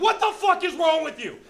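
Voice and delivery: high-pitched mock outburst from an exasperated wife; upset wife. Comic retelling of wife upset at his robot act, high mock outburst. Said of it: "she used to get upset about it".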